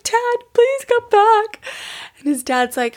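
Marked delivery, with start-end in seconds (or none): 0.0-1.6 put-on high, slow little-boy plea; crying little boy. Boy calling his dad back from bed, high slow whimper. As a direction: whimper it up high like a sad small child. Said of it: "And so he's crying".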